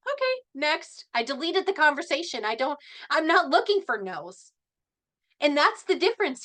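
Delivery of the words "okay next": high, slow, lightly sing-song okay, next; a carefree brush-off after being blocked; breezy dismissal. Retold or imitated. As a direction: shrug it off and move on. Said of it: "And I just went".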